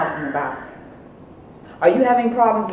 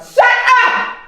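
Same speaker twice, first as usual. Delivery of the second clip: angry shout; 8 dB louder than usual. Acted. Mother explodes at daughter.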